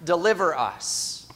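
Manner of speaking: a heartfelt cry of deliver us; earnest plea to be delivered; fervent prayer. Acted. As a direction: plead the words deliver us with fervor. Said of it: "from all of the struggles".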